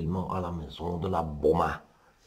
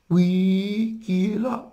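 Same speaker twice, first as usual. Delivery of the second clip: voix de fausset très aiguë, en faux; le loup se fait passer pour la mémé; loup imitant la grand-mère; 12 semitones higher, 4 dB louder, much slower than usual. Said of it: "qui imite maintenant la vioque". (voix de fausset très haute du loup)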